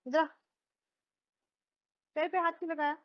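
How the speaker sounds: ordinary delivery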